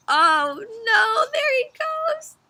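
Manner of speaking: high, mock-wailing; mock dismay. Reader fake-sad the cookie is gone. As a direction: wail it playfully.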